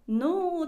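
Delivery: ノー stretched long, high and loud; long Spanish-style ノー trailing off; drawn-out no. Retold or imitated. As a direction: hold the ノー out while catching yourself. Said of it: "って伸ばしてる間に".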